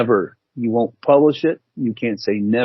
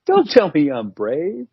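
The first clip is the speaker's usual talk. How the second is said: mock indignant; 9 semitones higher, slower than usual. Retold inner reaction, laughing.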